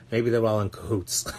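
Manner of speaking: laughing through the words. Real, not acted. Joking about the psychics, said through laughter. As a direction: chuckle it out, amused. Mocking the tarot readers, laughing.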